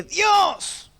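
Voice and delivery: retold cry of frustration; exasperated cry to God. Exasperated cry at punctured bicycle.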